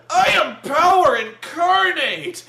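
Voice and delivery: slow, high, bellowed villain parody; gloating villain. Retold or imitated. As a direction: stretch the boast into a hammed-up shout. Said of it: "He goes, he goes".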